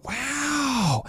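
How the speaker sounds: breathy high gasped wow; awed wow. Retold or imitated. Customer amazed by the service, breathy wow. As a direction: let the wow float up in awe. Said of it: "people go".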